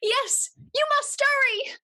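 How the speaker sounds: high Cockney urgent cry; Cockney servant pressing Marius to hurry; Gustav's Cockney voice. Pretend. To Marius, Gustav urges him in a high Cockney voice. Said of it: "Why is Gustav Cockney?".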